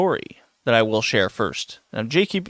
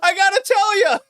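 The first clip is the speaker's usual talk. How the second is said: desperate fan calling. Retold or imitated. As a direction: beg the shopper to come back. Stocker calls after speaker.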